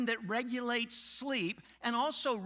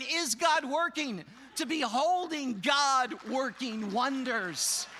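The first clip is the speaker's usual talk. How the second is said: loud, slow, God stressed; preaching emphasis; 3 semitones higher, 5 dB louder, slower than usual. Real, not acted. Preacher to congregation, slow loud wonder. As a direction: build to wonder, drawn out. Climactic sermon contrast.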